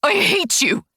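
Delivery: high shriek of hate. Acted. Wife shrieking at her husband before running off, high. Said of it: "I shriek".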